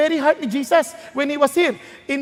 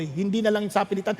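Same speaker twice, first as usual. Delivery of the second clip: usual speaking voice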